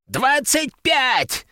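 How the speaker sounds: high, long-drawn squealed bid. A narrator's reading. Old collector tops the bid, drawn-out high squeal. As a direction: screech the bid out desperately. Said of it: "заверещал он".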